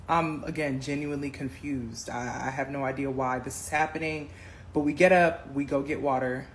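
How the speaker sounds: usual speaking voice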